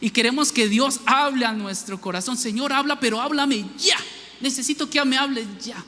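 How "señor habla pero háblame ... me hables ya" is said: higher, hurried, demanding an answer now; impatient prayer. Pretend. Believer demanding God speak right now, impatient and higher. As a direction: press God like a customer in a rush.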